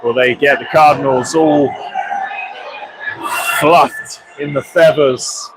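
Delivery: steady live hockey commentary; play-by-play. Acted. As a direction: call the game as it unfolds.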